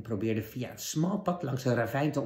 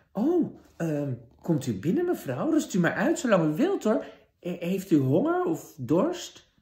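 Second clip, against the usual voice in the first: higher, lightly voiced kind girl; polite young girl; 6 semitones higher, 5 dB louder than usual. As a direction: welcome the tired guest sweetly.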